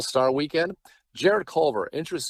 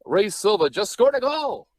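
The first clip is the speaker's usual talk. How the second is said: pitched high and drawn out, slow, a bit louder; voice going up too high; 7 semitones higher, 3 dB louder, slower than usual. Shown as a demonstration. Broadcaster showing his old habit, voice pitched too high. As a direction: overdo the upward lift like a rookie caller. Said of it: "Your voice goes up too high at the end of sentences".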